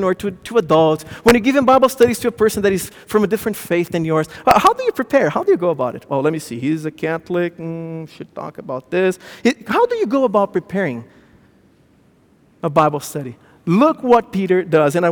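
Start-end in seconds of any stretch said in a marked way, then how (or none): 6.1-9.2 mock musing. Someone planning a Bible study, musing. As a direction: ponder it to yourself with a hum.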